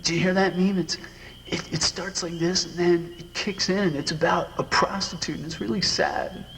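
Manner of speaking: put-on a kid gushing to grandma about a record; imitating excited kid self. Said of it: "and saying".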